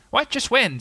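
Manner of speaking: high-pitched, uneasy question; a little uncomfortable. A narrator's reading. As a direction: play innocent, caught out. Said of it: "said Tigger, a little bit uncomfortably".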